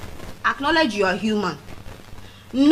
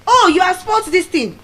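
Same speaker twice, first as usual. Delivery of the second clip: high, loud mock scolding; scolding parent; 9 semitones higher, 6 dB louder than usual. Parent scolding a child over damage, loud.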